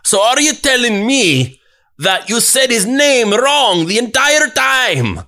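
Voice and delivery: high, loud Scottish disbelief; incredulous Scottish accent. Retold or imitated. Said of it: "my perfect Scottish accent".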